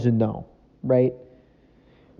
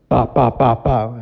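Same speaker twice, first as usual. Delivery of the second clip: knocking noise; 5 dB louder, slower than usual. Retold or imitated. Imitating knock on door.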